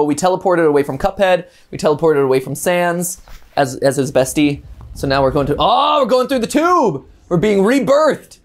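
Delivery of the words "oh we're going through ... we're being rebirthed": higher, slow drawn-out exclaiming; excited. Real, not acted. Reacting to game tube ride.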